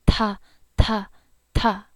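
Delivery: ta like an out-of-breath puff; breathy ta. Shown as a demonstration. Teacher demonstrating the aspirated ta. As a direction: push air out on each ta. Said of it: "out of breath sort of sound".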